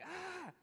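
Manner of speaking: a high mock yell of frustration; retold yell. Preacher recalls yelling at taken springs, high mock yell. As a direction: cry out "Ah!" like a let-down driver. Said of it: "like, yelling".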